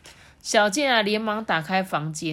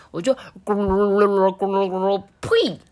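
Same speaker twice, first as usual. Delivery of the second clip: gurgling gargle then spit; while gargling; 3 semitones higher, 7 dB louder than usual. Retold or imitated. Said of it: "那你是怎么做的呢？". (漱口咕噜声)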